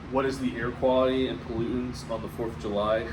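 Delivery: ordinary talk, nothing marked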